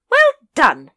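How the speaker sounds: loud, high lady's cheer; lady praising the ape with a loud high cheer; hearty praise. A narrator's reading.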